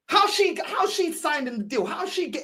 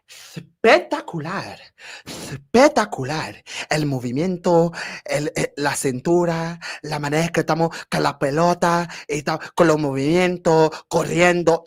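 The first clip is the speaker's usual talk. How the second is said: mock Arteta in Spanish; 6 semitones lower, 4 dB louder than usual. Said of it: "Oh, Arteta".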